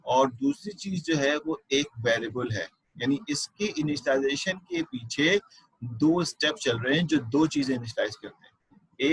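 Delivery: ordinary talk, nothing marked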